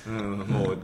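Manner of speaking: usual speaking voice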